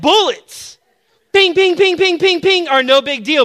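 high, loud ping pings; ricochet sounds. Retold or imitated. Preacher mocking Superman shrugging off bullets, high loud pings. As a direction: flick the bullets away with each ping.

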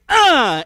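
loud, high effort grunt; acted-out grunt of striking in frustration; frustrated grunt. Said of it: "he was so frustrated".

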